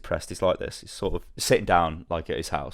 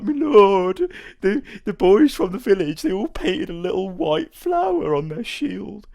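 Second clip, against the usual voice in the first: high, slow simpleton villager; plaintive peasant voice; 10 semitones higher, slower than usual. Acted. Villager tells the Witcher about painted shield, plaintive peasant voice. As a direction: drag it out in a high, comic peasant voice. Villager explains how to find brother.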